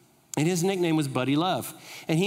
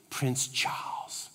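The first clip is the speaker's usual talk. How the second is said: slow breathy whisper of the name; 4 semitones lower, 7 dB quieter, much slower than usual. Retold or imitated. Shop lady revealing the royal guest in a slow whisper.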